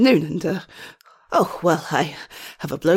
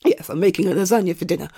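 normal talking voice

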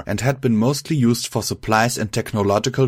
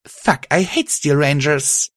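slow, higher-pitched irritation; a touch of exasperation, drawn out; very irritated; 6 semitones higher than usual. A narrator's reading. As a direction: grumble it slowly, pitched up. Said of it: "before getting very irritated".

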